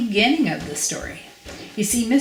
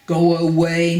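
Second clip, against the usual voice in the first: loud, rude dismissal; 6 dB louder than usual. Acted. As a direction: send him off rudely, raising your voice. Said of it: "Mister Uppity said rudely".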